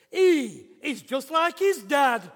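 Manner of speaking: big, high, drawn-out Yorkshire voice; broad Yorkshire accent. Retold or imitated. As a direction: blurt it out slowly in broad Yorkshire. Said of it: "broad Yorkshire accent".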